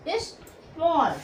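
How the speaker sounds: स्मॉल drawn out slowly with a falling end; modeling the word. Shown as a demonstration. Teacher modeling स्मॉल for the class to repeat. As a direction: say स्मॉल slowly for students to copy. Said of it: "क्या पढ़ोगे?".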